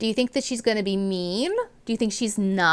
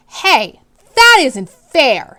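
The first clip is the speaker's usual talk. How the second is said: put-on loud, slow outraged child's cry; louder indignant schoolkid shout; indignant protest; 5 semitones higher, 12 dB louder, much slower than usual. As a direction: object loudly, it's unfair. Child protests teacher's punishment.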